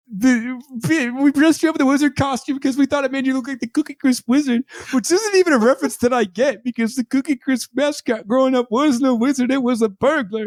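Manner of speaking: high-pitched, worked-up comic wail; Troy. Retold or imitated. Podcaster mimics a character explaining a wizard costume, high overwrought voice. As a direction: imitate an upset character, voice pushed way up. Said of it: "Troy, at the end of the episode, he's like".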